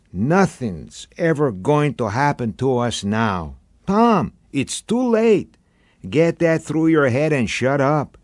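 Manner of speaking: put-on a blunt old-timer telling him straight, pressing harder toward the end; Chico, weary old beach bum. A narrator's reading. Chico's long speech to Tom.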